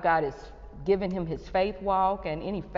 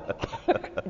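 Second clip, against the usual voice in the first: short voiceless laugh; amused laugh. Real, not acted. Host amused by her own porch fussing, laughing. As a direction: burst of real amusement.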